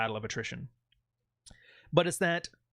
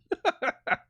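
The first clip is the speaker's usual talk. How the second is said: sharp high burst of laughter, louder; real laugh; 5 dB louder than usual. Real, not acted. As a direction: crack up at the comment. Laughing at a chat comment.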